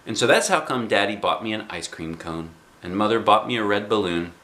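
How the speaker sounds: ordinary delivery, nothing marked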